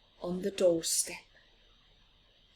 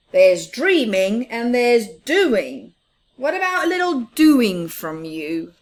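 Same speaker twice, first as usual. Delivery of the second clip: loud scolding; 3 semitones higher, 11 dB louder than usual. Acted. Big Mouse scolding dreamy Little Mouse, loud and firm. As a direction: tell him off from below.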